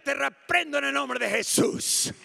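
te reprendo again in a firm high voice; firm rebuke. Retold or imitated. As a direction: press te reprendo en el nombre de Jesús. Rebuke repeated.

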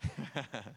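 a quick low chuckle at his own slip; short embarrassed laugh. Real, not acted. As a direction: laugh off the flub. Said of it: "Not that funny".

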